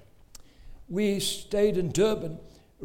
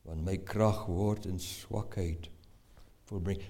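voice of God; 11 semitones lower, 4 dB quieter than usual. Acted. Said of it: "Het vir my gesê".

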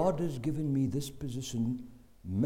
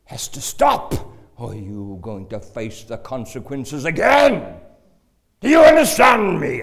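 dentist's stern shout; 14 dB louder than usual. Retold or imitated. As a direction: bellow it like a furious preacher. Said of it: "this man telling me I'm going to hell".

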